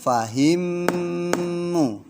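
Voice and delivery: Fahimmu, mm ditahan sangat pelan; held gunnah. Shown as a demonstration. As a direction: tahan mm di Fahimmu dua ketukan. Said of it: "Bacanya apa". (Fahimmu dengan dengung panjang)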